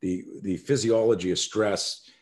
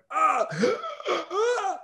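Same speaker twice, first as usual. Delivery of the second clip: much higher, gasping fake cries of pain; faked pain cries. Boy faking injury to fool attackers, high fake pain cries. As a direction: sell broken ribs with high strangled gasps. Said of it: "I pretended like my ribs were broken and I couldn't breathe".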